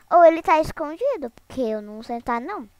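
ordinary delivery

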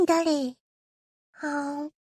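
ordinary delivery

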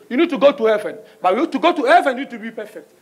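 normal talking voice

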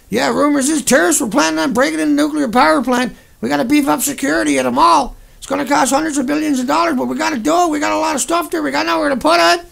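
breezy, dismissive PR voice, mocking it; mocking spokesman. Ranting streamer mocking nuclear spokesman on radio, mock-breezy PR voice.

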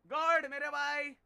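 drawn-out shout across the game; calling out. Real, not acted. Calling his friend God in game. (धीमी, ज़ोरदार पुकार)

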